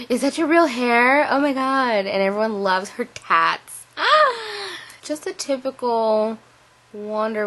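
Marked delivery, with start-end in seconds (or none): 0.1-2.1 higher, louder, gushing amazement; a stranger gushing over hair; amazed stranger. Retold or imitated. 4.0-4.8 high delighted squeal; sudden high squeal. Retold or imitated. Squealing at the tattoos.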